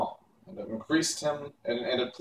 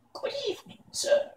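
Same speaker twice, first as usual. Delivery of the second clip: high, thin cackling crone greeting; old witch voice; 4 dB quieter than usual. A narrator's reading. Old witch Mombi greets the stranger in a high crone voice.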